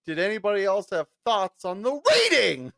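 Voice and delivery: laughing, lifted teacher voice; mock-cheerful teacher through a laugh; breezy teacher. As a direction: move on brightly as if nothing happened. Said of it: "He goes".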